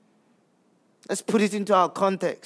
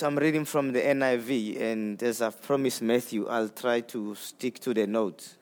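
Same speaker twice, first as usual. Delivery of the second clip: ordinary talk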